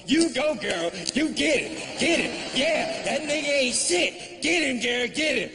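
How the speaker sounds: high-pitched cheering on, acted; women egging on. Women around her back their friend, high egging on. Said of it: "And the women around her going".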